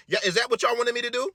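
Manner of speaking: normal talking voice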